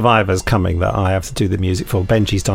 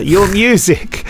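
slow and higher, relishing it; savouring the praise; 9 semitones higher, 3 dB louder, much slower than usual. Acted. Host reading a listener's compliment, slow and relishing. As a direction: stretch the words out proudly.

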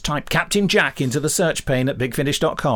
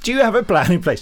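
mock indignant; 7 semitones higher, 6 dB louder than usual. Mockingly repeats question at departing colleague.

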